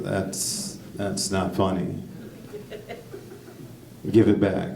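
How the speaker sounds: put-on flat unamused hush from the girlfriend; deadpan girlfriend. Girlfriend staring him down, quiet deadpan. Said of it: "She looks me directly in my face and is like".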